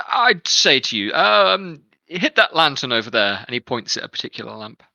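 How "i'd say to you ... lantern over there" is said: guard captain. Acted. Captain gives challenge.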